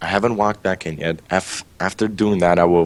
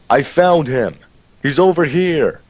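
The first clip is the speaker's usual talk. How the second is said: acted yell; 10 semitones higher, 4 dB louder than usual. Player yelling at the cave exit to lure the guys, acted yell. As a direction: call out to the others as if from a distance. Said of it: "not scream, but yell".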